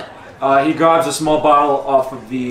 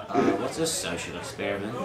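confused kobold; 9 semitones lower, 13 dB quieter than usual. Acted.

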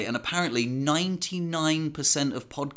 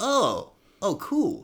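surprised; 9 semitones higher, 4 dB louder, much slower than usual. Retold or imitated. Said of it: "And I was like".

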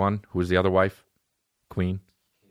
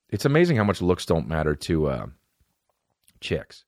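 ordinary talk, nothing marked

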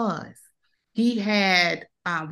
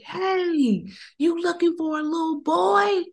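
put-on higher-pitched girl calling out; young girl voice; 9 semitones higher than usual. Stranger girl's voice retold.

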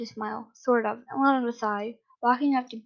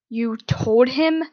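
loud, drawn-out disbelieving yell; angry yell; 6 dB louder, much slower than usual. A narrator's reading. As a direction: yell it slowly in outrage. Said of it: "Aizawa yelled".

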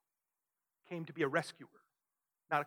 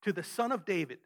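raised, louder voice of an acclaiming crowd; mild retold crowd shout; 4 dB louder than usual. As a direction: proclaim it like a cheering crowd, held back. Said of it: "the people are shouting".

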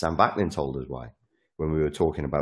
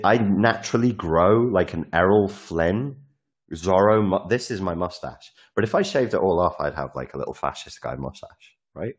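ordinary talk, nothing marked

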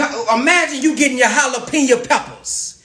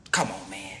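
whispered aside; 12 dB quieter than usual. Real, not acted. Aside after the pitch.

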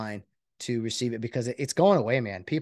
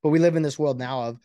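normal talking voice

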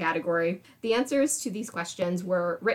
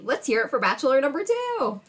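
booming pumped announcer; 6 semitones higher, 4 dB louder than usual. Acted. Game show host intro.

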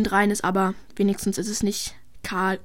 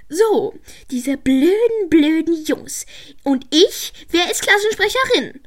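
higher, louder outraged complaint; indignant outburst; 5 semitones higher, 5 dB louder than usual. Acted. Piper furious that nobody voted for her, loud indignant rant. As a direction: rant at the boys, demanding an answer.

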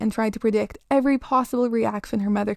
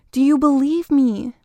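put-on higher, louder child's demand; desperate plea; 4 semitones higher, 6 dB louder than usual. A narrator's reading. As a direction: press your mother, desperately. Child begging mother to believe her.

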